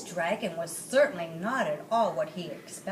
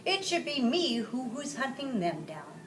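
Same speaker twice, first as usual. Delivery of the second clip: usual speaking voice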